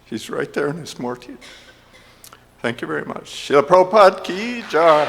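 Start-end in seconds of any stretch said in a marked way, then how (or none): 3.5-5.0 high, loud devotional call-out; ki jai call. Real, not acted. Speaker ending his remembrance to the temple crowd, loud jubilant call. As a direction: rouse the crowd to answer jai.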